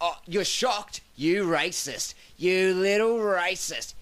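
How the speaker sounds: high, slow, hammed-up accusing tone; mock outrage. Drunk heckler accuses the shocked comic, mock outraged. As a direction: turn the tables with fake indignation.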